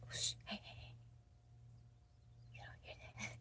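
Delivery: soft secretive whisper on request. Pretend. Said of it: "tell me your voices".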